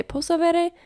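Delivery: lifted, friendly asking voice; with a smile. Shown as a demonstration. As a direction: ask sweetly in Italian with a smile. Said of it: "with a smile, you can say".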